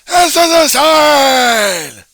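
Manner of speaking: long, high, drawn-out scream; screaming head off. Acted. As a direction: yell your head off, stretching it out. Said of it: "screaming and yelling my head off at people".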